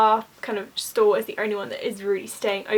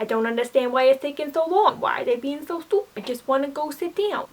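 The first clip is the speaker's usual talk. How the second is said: a grouchy grandma, imitated in a rough New York accent, a bit higher; 4 semitones higher than usual. Grouchy New York grandma complaining about the long wait, in a mock New York accent. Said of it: "My accent is horrible".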